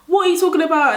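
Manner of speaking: high-pitched mock confusion; re-enacted puzzled question; imitated bafflement. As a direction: play the classmate who has no clue. Baffled classmates acted out.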